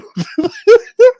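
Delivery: high, loud burst of laughter; real laugh. Real, not acted. Host amused at someone getting busted, laughing. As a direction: crack up in shrieky high bursts.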